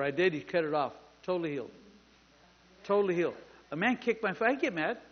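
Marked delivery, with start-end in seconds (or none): none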